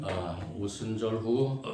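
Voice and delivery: ordinary talk, nothing marked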